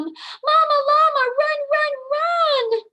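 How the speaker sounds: very high, loud urgent cry; urgent shout. Acted. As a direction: shout mama to come running. Urging mama to hurry.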